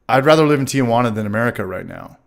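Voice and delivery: ordinary delivery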